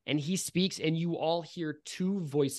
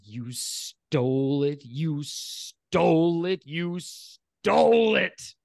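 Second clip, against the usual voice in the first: eerie accusing voice; 3 semitones higher, 5 dB louder, much slower than usual. Acted. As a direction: hiss it out, growing into a wail. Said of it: "the voice says".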